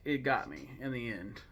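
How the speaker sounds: ordinary talk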